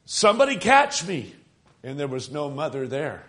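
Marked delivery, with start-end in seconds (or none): 0.0-1.3 retold scream. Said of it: "And I screamed for my mother".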